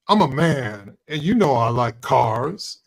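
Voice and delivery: mock stilted ad testimonial, drawn out at the end; put-on, lightly hammed-up sincerity; fake, inauthentic. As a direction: imitate a badly scripted actor declaring himself. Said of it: "so fake and inauthentic".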